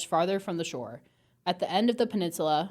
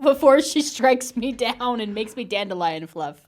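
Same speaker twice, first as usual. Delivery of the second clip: playful dare; 5 semitones higher, 10 dB louder than usual. Pretend. Acting as Warren daring closer.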